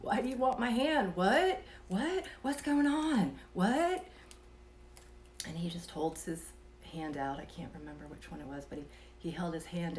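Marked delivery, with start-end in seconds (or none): 0.0-4.1 high, loud, frantic questions; alarmed wife shouting questions at her sleepwalking husband; panicked. Retold or imitated. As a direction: freak out at him, pitch climbing. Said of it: "I started freaking out".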